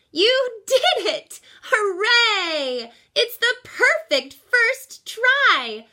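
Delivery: high, loud, slow cheer broken by laughing and crying; laughing and crying with joy. Acted. Great aunt cheering Rosie's crashed first try, laughing and crying. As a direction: burst out laughing through happy tears. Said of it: "started to cry".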